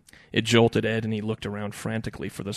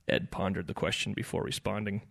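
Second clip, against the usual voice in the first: ordinary delivery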